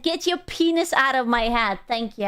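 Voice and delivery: usual speaking voice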